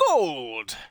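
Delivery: high barker voice; carnival barker. Acted. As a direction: sell the prize like a barker. Barker NPC offers the prize.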